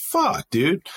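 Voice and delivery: exasperated complaint to a buddy; retold exasperation. Soldier grumbling at being reported late, exasperated.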